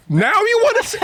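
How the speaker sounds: very high, loud hammed-up outraged wail; angry cry, imitated. Son protesting dad's late approval, mock angry tearful wail. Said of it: "that cry when you just, like, angry".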